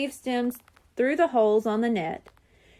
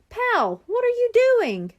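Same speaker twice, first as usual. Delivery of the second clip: high, louder startled call to the dog; a boy catching his dog in the berry patch; 10 semitones higher, 4 dB louder than usual. Acted. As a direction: call out in disbelief. Bradley calls to his dog.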